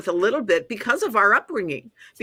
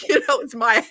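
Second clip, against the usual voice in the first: ordinary delivery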